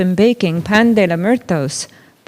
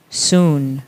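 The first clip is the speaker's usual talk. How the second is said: calm mother; 5 semitones lower than usual. Acted. Mama answers children.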